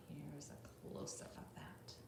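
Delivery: soft whisper. Acted. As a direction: hush it like a secret. Whispered aside to children.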